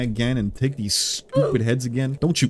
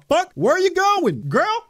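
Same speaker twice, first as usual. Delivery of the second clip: much higher, incredulous calling out; shocked outcry; 4 dB louder than usual. Real, not acted. Gamer yells after a fleeing girl, shocked. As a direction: call after her in disbelief. Cripple runs off.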